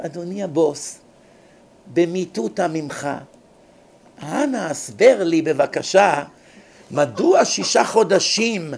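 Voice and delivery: slow, formal, exaggeratedly courteous; mock overpolite. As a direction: plead with the boss in stilted politeness. Mock overpolite complaint to boss. (נימוס מוגזם ומדומה, איטי וחגיגי)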